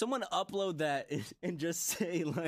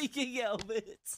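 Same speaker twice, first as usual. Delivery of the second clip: high amused echo; 8 semitones higher, slower than usual. Real, not acted. Owns the slip, cracking up.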